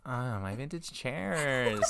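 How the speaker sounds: put-on slow, exasperated valley girl voice with a sigh. Shown as a demonstration. Redoing the chair bit for the cohost, slow exasperated valley girl voice.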